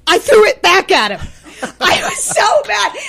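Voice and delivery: high, loud confession through laughter; laughing, worked-up admission; laughing outburst. Retold or imitated. Laughing confession of losing her temper.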